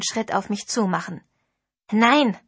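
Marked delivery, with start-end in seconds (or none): 1.9-2.4 louder, a sharp rebuke; snapping no. Acted. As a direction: cut her off hard. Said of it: "fuhr Damien sie an".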